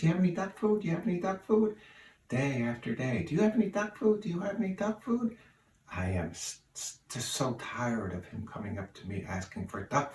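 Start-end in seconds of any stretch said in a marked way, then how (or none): 0.0-1.8 high squawky duck begging for food. Acted. Joke: speaker voices a begging duck. 3.2-5.4 high squawky duck begging for food. Acted. Joke: speaker voices a begging duck.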